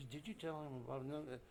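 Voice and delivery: soft, fast aside; wife asks her husband quietly and fast; hushed aside. Retold or imitated. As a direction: murmur it behind his back.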